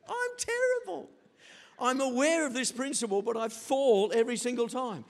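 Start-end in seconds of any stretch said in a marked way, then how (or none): 0.1-1.1 high squeal through laughter; laughing confession. Real, not acted. Laughing confession about own weakness.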